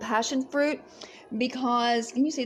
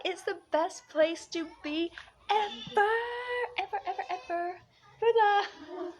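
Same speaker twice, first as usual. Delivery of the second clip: high, fading airy voice; dreamy high voice; 8 semitones higher than usual. Pretend. Floating high to viewers about Fiji. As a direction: let it float up and fade away.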